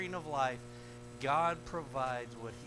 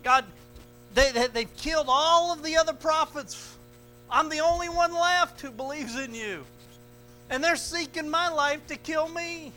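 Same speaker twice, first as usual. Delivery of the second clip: Elijah pouring out his complaint, much higher and louder; Elijah's complaint; 11 semitones higher, 11 dB louder than usual. Acted. Elijah complaining to God in the cave, raised and pleading. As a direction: cry out to God like a man at the end of his rope. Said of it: "Elijah begins to just lay out his complaint before God".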